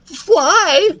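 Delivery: very high, squeaky question; alarmed little voice; nervous. A narrator's reading. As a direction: squeak the single word without stammering. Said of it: "Lucas asked nervously".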